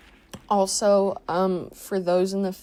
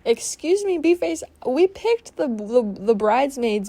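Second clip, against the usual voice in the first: high, loud indignant retort; 6 semitones higher, 7 dB louder than usual. Acted. Talking back at B-Face, high indignant outrage. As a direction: huff it back at her, outraged.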